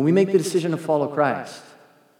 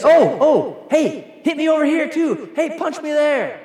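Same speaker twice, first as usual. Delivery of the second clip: higher, hammed-up begging to be hit; mock eager volunteer; 12 semitones higher, 4 dB louder, slower than usual. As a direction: call out in mock eagerness, high and insistent. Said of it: "He didn't just say".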